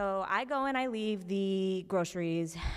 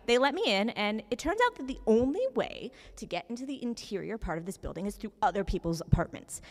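ordinary delivery

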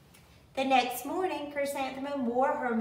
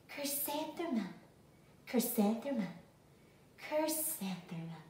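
soft, hushed, fading lower each time; softer and softer, savoring her name; hushed to herself; 2 semitones lower, 8 dB quieter than usual. A narrator's reading. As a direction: murmur the name softly into the mirror. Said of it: "when she whispered it to herself".

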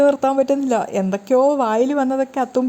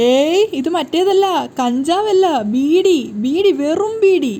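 insistent denial; 5 semitones higher, much slower than usual. Acted. Old Ayyappettan to the girl, flustered insistent denial. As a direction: hammer home that it's only a beedi. Old man denies the beedi is ganja.

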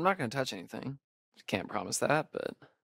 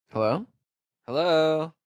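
louder call into the dark; 4 semitones higher, 6 dB louder than usual. Acted. Thinks he heard someone, calls out louder. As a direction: call out to whoever is there.

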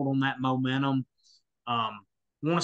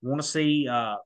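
ordinary talk, nothing marked

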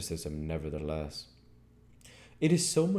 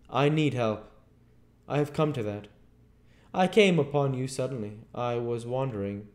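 raised, emphatic voice telling his plight; higher, louder, urgent confession; intense Invisible Man; 7 semitones higher, 7 dB louder than usual. A narrator's reading. Invisible stranger explains his need to Marvel, intense and urgent.

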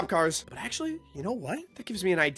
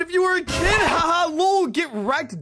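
loud, high jeer in imitation; gloating kid-cop mockery; mocking taunt; 8 semitones higher, 6 dB louder, slower than usual. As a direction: crow over the victim, pitched way up.

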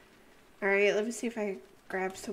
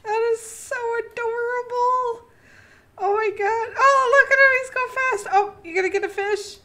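high gushing coo at a cute pet; 12 semitones higher, 10 dB louder than usual. Real, not acted. Cooing at a cute creature.

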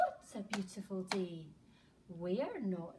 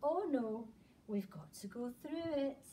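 usual speaking voice

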